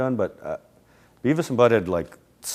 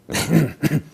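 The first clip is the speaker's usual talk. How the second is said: high, louder spastic snicker through a bitten lip; imitated excited snicker; 8 semitones higher, 6 dB louder than usual. Imitating an excited classmate around the hot teacher, spastic snicker. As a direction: snicker in stuttering bursts, biting your lip. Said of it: "biting his lip all the time and just kinda going like".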